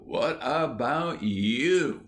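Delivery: slow, drawn-out what about you; a boy rubbing it in, slowly; drawn-out taunt. A narrator's reading. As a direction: stretch each word of what about you. Ginger repeats retort.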